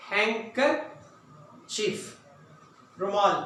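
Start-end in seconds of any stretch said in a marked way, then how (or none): 0.1-2.0 हैंकरचीफ very slow, no d sound; slow correct pronunciation. Shown as a demonstration. Teacher corrects the class, said very slowly without d. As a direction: stretch हैंकरचीफ, drop the d. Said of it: "बाकी आप पढ़ें".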